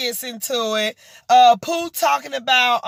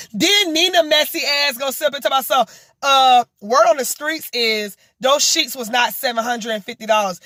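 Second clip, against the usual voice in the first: ordinary talk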